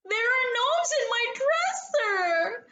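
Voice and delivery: high, frightened shriek; girl shrieking to her father. A narrator's reading. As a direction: cry it out in alarm. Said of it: "shrieked Penelope".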